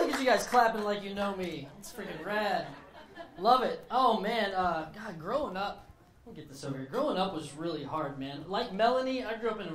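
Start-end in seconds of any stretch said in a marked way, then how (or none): none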